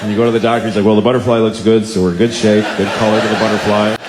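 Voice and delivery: low, brisk examining doctor; matter-of-fact doctor. Acted. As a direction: report findings like a routine check-up. Doctor voiced during an exam.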